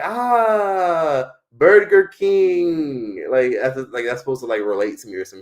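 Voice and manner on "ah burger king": high, drawn-out "Ah, Burger King"; mocking foreigner's slow, loud "Burger King"; foreigners mocking Americans. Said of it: "they're like".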